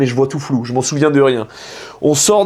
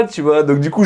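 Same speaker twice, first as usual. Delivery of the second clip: normal talking voice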